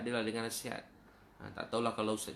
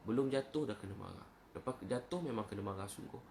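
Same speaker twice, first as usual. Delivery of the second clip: ordinary delivery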